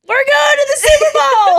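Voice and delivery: high, loud cheering shout; excited shout. Real, not acted. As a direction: yell it out in delight. Boyfriends' team reached Super Bowl.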